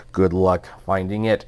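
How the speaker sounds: usual speaking voice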